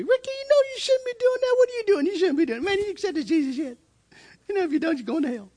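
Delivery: high, pleading preachy voice, rushing at the end; high-pitched imitation of a preachy schoolmate; mock preachy friend. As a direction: play the pushy believer friend warning him. Friend Sean preaching at him.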